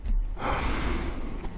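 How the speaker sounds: long, soft exhale; disgusted sigh. Real, not acted. Commentator disgusted by the hypocrisy, weary sigh. Disgusted pause after rant.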